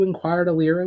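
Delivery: ordinary delivery, nothing marked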